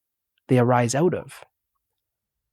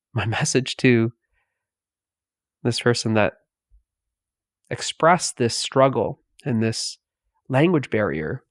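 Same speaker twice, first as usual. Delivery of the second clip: ordinary talk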